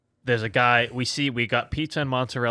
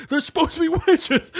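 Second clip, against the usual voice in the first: laughing while quoting; 6 dB louder than usual. Retold or imitated. Retelling customer's rant, laughing.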